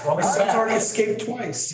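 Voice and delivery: laughing. Real, not acted. Administrator jokes to the board about her voice, laughing. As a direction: chuckle it out, amused at yourself.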